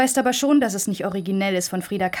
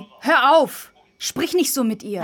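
louder sharp stop; sharp rebuke; 5 semitones higher, 4 dB louder than usual. Acted. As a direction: cut her off sharply. Sister tells her to stop.

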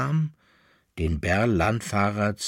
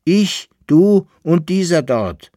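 loud, high and slow, one by one; emphatic answer; 8 semitones higher, 9 dB louder than usual. A narrator's reading. Bärlandfahrer answers who will be hanged, loud emphatic answer. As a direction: hammer out each person named.

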